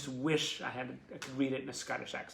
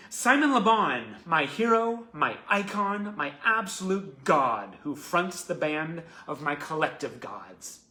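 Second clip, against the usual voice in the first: emphatic, louder read of a devoted tribute; fan letter reading; 4 semitones higher, 12 dB louder, slower than usual. Real, not acted. Host reads John's tribute to Simon on air, emphatic letter reading. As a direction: proclaim each word of praise.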